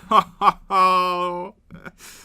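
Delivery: bursting out laughing; big real laugh; loud laugh. Real, not acted.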